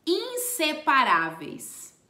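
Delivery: usual speaking voice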